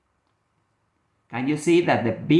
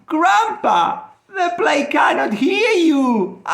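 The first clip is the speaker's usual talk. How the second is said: shouted through high laughter; girl laughing loudly at grandpa; 12 semitones higher, 4 dB louder than usual. Acted. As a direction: laugh at grandpa while calling out. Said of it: "Natty started laughing".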